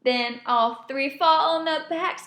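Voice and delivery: plain reading voice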